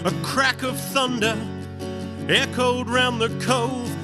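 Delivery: usual speaking voice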